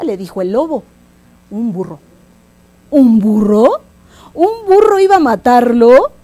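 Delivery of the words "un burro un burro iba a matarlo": loud, rising scoffing question; incredulous wolf. Acted. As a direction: repeat it in booming disbelief. Wolf cannot believe the lion's answer.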